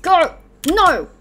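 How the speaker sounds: short, loud outburst of frustration; frustrated shout. Real, not acted. Player yelling at the game mid-match, frustrated shout. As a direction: yell it high and loud.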